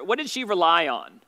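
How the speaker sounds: normal talking voice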